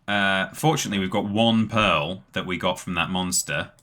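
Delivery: ordinary delivery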